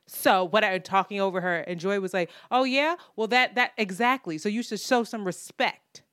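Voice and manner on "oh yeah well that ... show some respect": louder, higher mock-indignant retort; indignant comeback. Veteran host demanding respect from co-host, mock indignant. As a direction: imitate a veteran putting down an upstart. Acted quarrel between two TV hosts.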